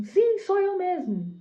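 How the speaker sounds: high, loud, drawn-out acted declaration; defiant shout. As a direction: own up boldly, stretching it out. Defiant answer to the leader, retold.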